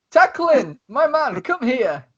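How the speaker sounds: high, loving summons for a hug; affectionate Tesla. Acted. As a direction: open your arms with your voice. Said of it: "So much love is coming off Tesla".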